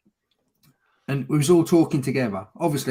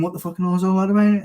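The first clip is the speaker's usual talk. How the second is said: raised, higher voice of a drunk mocking; mock outburst; 6 semitones higher, 5 dB louder than usual. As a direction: shout it out higher, taunting. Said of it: "I was going".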